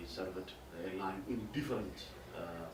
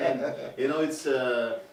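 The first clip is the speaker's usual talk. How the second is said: talking while the laugh fades out; 4 semitones higher, 10 dB louder, slower than usual. Real, not acted. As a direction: still laughing through the words. Laughing after own point.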